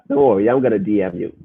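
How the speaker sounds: normal talking voice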